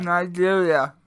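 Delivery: loud, through a mouthful of banana; mouth full. Real, not acted. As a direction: mumble it through food. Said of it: "With a mouthful of banana".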